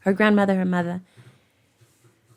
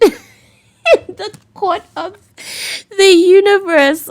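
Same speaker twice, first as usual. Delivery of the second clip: laughing; 13 semitones higher, 7 dB louder, much slower than usual. Real, not acted. Laughing dramatic reading aloud.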